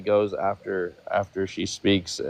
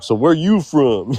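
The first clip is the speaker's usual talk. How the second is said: higher, drawn-out mock question; 5 semitones higher, 5 dB louder, slower than usual. Panel host acting out how not to greet a guest, mock questioning. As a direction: imitate a nosy questioner, louder and stretched out. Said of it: "and not be like".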